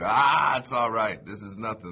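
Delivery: loud upbeat reassurance; reassuring. Retold or imitated. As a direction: buck him up, brushing it off. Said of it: "to instill confidence in Dan".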